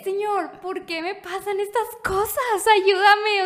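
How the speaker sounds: high, slow plea to God, rising to a loud cry at the end; súplica llorosa. Retold or imitated. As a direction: beg God in despair. Llorando y rezando en el carro. (súplica desesperada a Dios, aguda)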